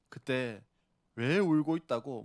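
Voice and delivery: normal talking voice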